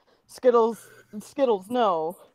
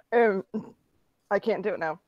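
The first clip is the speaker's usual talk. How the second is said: usual speaking voice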